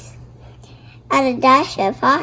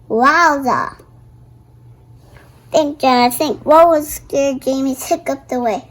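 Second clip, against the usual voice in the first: ordinary talk, nothing marked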